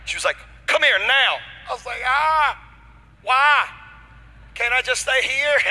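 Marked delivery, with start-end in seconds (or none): 0.7-1.4 loud, high imitation of an agent; mock-authoritative command; authoritative order. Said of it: "I hear her get very authoritative". 2.0-5.6 high, loud comic whine; hammed-up reluctance; mock scared pleading. Scared man pleading with agent.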